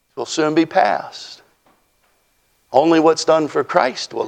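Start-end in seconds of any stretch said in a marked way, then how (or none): none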